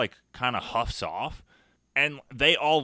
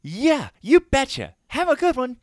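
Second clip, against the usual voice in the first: much higher, slow, sing-song cheer; cheery hiker; 13 semitones higher, 6 dB louder than usual. Retold or imitated. Cheerful hiker answering the speaker, sing-song and much higher. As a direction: play the beaming happy hiker. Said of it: "And she goes".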